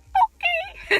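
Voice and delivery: very high, loud, drawn-out mock reply; awkward squeak. Coworker retreating from a snappy customer, high and awkward. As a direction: imitate a friend backing off in a squeaky voice. Friend backing away from angry customer.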